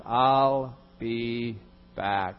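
slow, deadpan movie-robot line; slow mock action-hero threat; Terminator catchphrase. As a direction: drag it out like the Terminator. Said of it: "three words".

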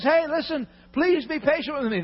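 high, desperate begging; pleading. Acted. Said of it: "The guy says".

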